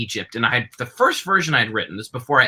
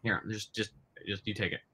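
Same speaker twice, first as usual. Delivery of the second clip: quiet aside; 3 semitones lower, 16 dB quieter than usual. Real, not acted. Handing back gum.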